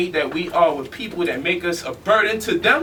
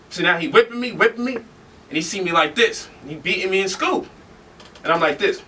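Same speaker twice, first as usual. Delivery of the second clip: normal talking voice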